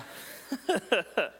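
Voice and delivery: bursting into a high laugh. Real, not acted. Preacher laughing along with the class.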